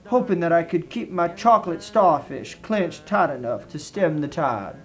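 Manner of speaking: ordinary delivery, nothing marked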